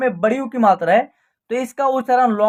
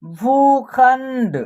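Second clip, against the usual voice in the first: slow, stretched syllables on a level pitch; drawn-out word drill; 4 dB louder, much slower than usual. Shown as a demonstration. Teacher reading out a Hindi word list for practice, drawn out. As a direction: read each word slowly, holding the long vowels.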